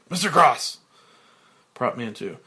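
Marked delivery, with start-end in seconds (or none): 0.1-0.8 loud, sharp bark of a name; snapping. Acted. Frank snapping at the new prop man, loud and sharp. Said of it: "Frank, snapping".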